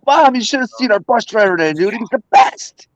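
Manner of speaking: hammed-up excited child; excited kid telling Mom. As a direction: gush like a kid just home from school. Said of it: "the stories they told their parents".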